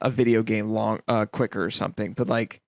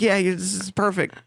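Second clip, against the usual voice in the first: eager salesman; 7 semitones higher than usual. Retold or imitated. Salesman pitching hard, retold.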